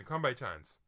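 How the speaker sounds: ordinary talk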